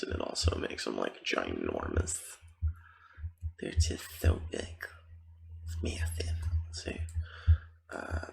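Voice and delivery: hushed, mostly voiceless game commentary; ASMR whisper. Real, not acted. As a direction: whisper casual gameplay chatter to the listener.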